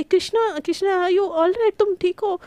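frightened shout of her name; alarmed calling out. Acted. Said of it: "एकदम से चिल्लाती है".

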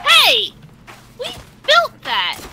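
high, loud protest; indignant shout. Real, not acted. Builder yelling at attackers, high indignant shout. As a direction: yell at someone wrecking your work. Enemies break their buildings.

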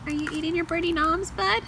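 high sing-song voice cooing to the birds. Real, not acted. Owner cooing at her parrots on their swing, high sing-song baby talk.

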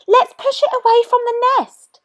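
high, loud urging; urgent call. A narrator's reading. Moana urges her friends to hurry. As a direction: push everyone to hurry. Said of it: "urged Moana".

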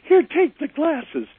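meek giving in. Retold or imitated. To [name], giving in over the glasses, squeaky high.

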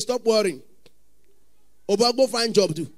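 ordinary delivery

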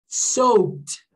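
"soaked" as "sowt", the k swallowed; subtle consonants. Shown as a demonstration. Teacher modelling the word, subtle consonants. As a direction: glide over the k in "soaked" to a light t. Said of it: "você falou meio que".

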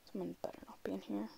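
nervous whisper. Real, not acted. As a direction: whisper it while hiding. Hiding, fearing others.

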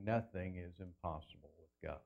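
low, soft recital of the verse; hushed verse quote. Shown as a demonstration. Preacher quoting the words spoken to Mary, hushed. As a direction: say it low and softly, in one go.

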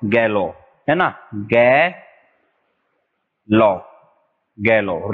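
गैलो said slowly, the r silent; pronunciation demo. Shown as a demonstration. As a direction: sound out गैलो carefully for the class. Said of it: "रे इसमें silent रहता है". (गैलो का धीमा साफ़ उच्चारण)

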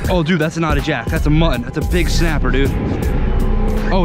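normal talking voice

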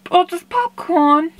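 low, put-on big-bear voice; a kid's low growly teddy voice asking its brother; gruff bear voice. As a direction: drop into a deep, dopey bear. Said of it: "Berry Bear says".

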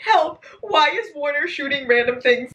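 incredulous outcry. Real, not acted. Reader reacting to Warner shooting random things, incredulous outcry. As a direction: cry out in disbelief.

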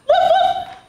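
high, loud playful woof; puppy bark. Acted. Teacher showing kids the puppy bark, high and loud.

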